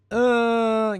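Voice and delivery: a high, loud, drawn-out "uh" of disbelief; incredulous "uh". Real, not acted. Hearing Darius is sent north to the Freljord, an incredulous loud high "uh". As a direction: react with a long, high, doubting "uh".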